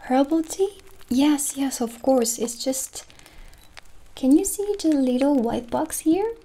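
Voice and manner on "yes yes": ordinary talk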